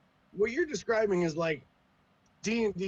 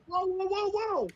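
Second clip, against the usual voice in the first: much higher, alarmed whoa; mock startled whoa. Newcomer overwhelmed by instant role-play, mock alarm. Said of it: "and you're like".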